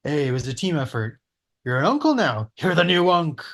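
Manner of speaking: ordinary delivery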